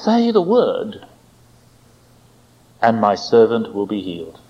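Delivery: slow reverent appeal, falling low at phrase ends; confident humility. Retold or imitated. Centurion answers Jesus, slow and humbly confident. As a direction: plead slowly, humble but sure. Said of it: "interesting mix of confidence and humility".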